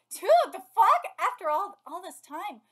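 loud, high disbelief; loud baffled reaction; incredulous outburst. Real, not acted. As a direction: blurt it out, baffled.

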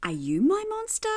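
ordinary delivery

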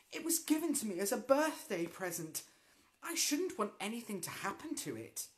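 protesting. Acted. Ethel protesting to the teacher about her birthday broom. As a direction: whine about her present. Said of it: "cried Ethel".

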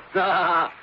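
short polished laugh; smooth chuckle. Acted. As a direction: laugh it off lightly. Uncle chuckles.

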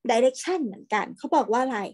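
ordinary talk

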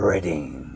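"Reading" launched from a long animal-growl R. Shown as a demonstration. Teacher exaggerates R for learners, long growled R in "Reading". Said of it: "으르르 할 때 그 알발음이에요".

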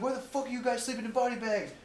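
very high-pitched incredulous question; mock horrified demand. Comrades to men in body bags, mock horrified disbelief. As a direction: ask it in comic disbelief, voice way up.

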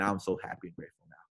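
a fast, quiet repetition of the mantra; quiet quick mantra. Shown as a demonstration. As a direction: murmur the affirmation quickly. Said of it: "I say way more".

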